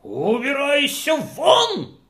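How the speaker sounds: the rich host ordering the guest out, shouting slowly. Acted. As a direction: throw him out with a yell. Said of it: "закричал хозяин".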